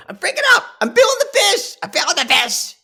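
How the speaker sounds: very high, loud panicked squeal; mock freak-out. Retelling the swimmer's panic about fish, high mock squeal. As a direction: squeal like the fish just brushed you. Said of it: "she goes".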